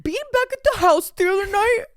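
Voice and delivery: high, loud mocking voice; partner impression. Retelling partner's comeback, high mocking voice. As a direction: mimic him in a squawky high pitch.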